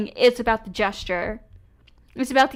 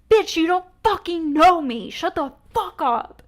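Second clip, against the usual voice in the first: angry outburst; 5 semitones higher than usual. Real, not acted. Panelist lashing out at a rival panelist, angry. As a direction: fire it back at her. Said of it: "I'm getting so mad".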